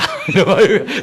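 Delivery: burst of laughter. Real, not acted. Pastor cracking up after the Gyeongsang dialect psalm, high laugh. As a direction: laugh at your own performance.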